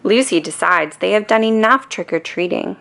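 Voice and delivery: usual speaking voice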